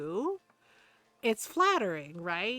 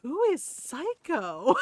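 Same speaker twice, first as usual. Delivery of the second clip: slow, higher and louder mock disbelief; retold incredulous question; 5 semitones higher, 5 dB louder, slower than usual. Listener asks about the odd nickname, incredulous. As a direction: draw the question out, raised and puzzled.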